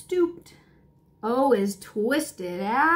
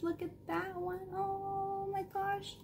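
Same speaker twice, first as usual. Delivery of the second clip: hushed; 8 semitones higher, 14 dB quieter than usual. Real, not acted. Pointing at Band-Aid picture.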